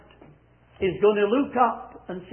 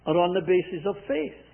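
ordinary talk, nothing marked

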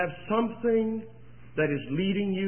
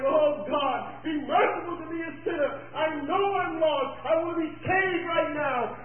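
high, pleading cry to God, retold with feeling; retold crying plea; 7 semitones higher than usual. Preacher retelling a broken father praying on his knees, high pleading cry. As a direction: beg God for mercy through tears, imitating the father. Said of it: "and he was crying".

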